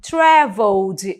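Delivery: "Traveled" drawn out, no E sound before the D; teacher's demo of "Traveled" with the -ed E dropped; silent final E. Shown as a demonstration. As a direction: say "Traveled" slowly as "travold". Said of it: "eu já não pronuncio esse E do final".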